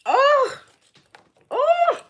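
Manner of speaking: very high, loud delighted squeal; excited squeal. Real, not acted. Seller squealing with joy after the buyer wins. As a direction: shriek with joy at the sale.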